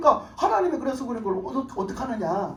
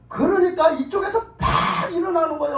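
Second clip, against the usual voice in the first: loud outburst; 7 semitones higher, 6 dB louder than usual. Retold or imitated. Pastor telling how the husband jumped up, loud and high. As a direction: shout it out, pitch jumping high. Husband erupting during counseling.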